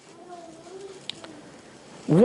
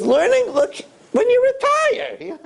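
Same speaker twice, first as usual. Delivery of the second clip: mock coaxing. Evil inclination stalling someone who would learn, mock coaxing. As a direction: stretch it out high, laughing at the excuse.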